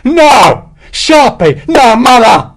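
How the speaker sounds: very high, loud screams of terror; terrified scream. Acted. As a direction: shriek in panic at the tigers. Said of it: "Hexwell saw the animals and screamed".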